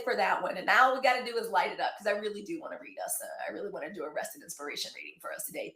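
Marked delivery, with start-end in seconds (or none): 2.0-5.7 fast, much softer aside; tossed off under the breath; quick aside. Real, not acted.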